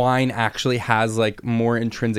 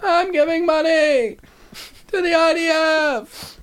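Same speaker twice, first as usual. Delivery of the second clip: very high, drawn-out comic caricature; Seinfeld impression; 3 dB louder, much slower than usual. Joking to a co-host about a comedian, high exaggerated impression. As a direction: stretch each word way up high in a hammed-up voice. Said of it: "My impression of him?".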